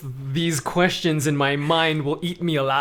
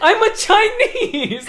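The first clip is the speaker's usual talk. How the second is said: much higher, slow, laughing caricature voice; a much higher, slow, laughing caricature voice answering a nosy stranger; hammed-up mock answer; 5 dB louder, slower than usual. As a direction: overplay it, giggling. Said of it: "What do you want me to say".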